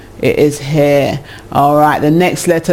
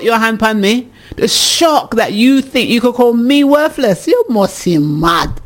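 indignant telling-off; 6 semitones higher than usual. Acted. Woman answering a partner who called her worthless, indignant. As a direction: tell them off in disbelief, pitched up.

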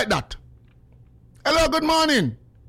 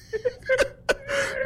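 real laugh; 14 semitones higher, 5 dB quieter than usual. Real, not acted. Laughing at own story.

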